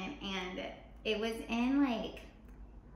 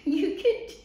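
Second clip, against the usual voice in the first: laughing; 5 dB louder than usual. Retold or imitated. Laughing while quoting surgeon.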